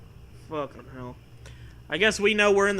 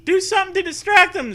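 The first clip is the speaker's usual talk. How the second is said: much higher, fast panicky plea; panicked Zoe; 14 semitones higher, 6 dB louder, faster than usual. Acted. Cousin Zoe begs you in the elevator, high and fast. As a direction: rattle it off in a squeal of panic. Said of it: "Zoe says".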